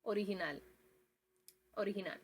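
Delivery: ORIGINAL as o-ree-hee-NAL; Spanish pronunciation. Shown as a demonstration. Teacher quizzes viewers on language, Spanish pronunciation of original. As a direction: say "original" the Spanish way, g as breathy h, pure vowels. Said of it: "and Spanish".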